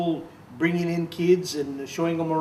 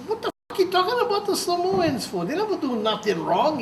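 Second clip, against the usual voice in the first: much higher, indignant retort; retold angry outburst; 13 semitones higher than usual. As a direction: fire back at him, outraged. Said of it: "I got really pissed off".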